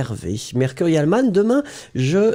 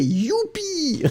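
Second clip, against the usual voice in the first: gleeful cheer; 4 dB quieter than usual. Acted. Cheers reading a day off.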